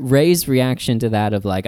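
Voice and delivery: normal talking voice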